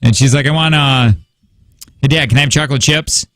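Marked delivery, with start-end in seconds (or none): none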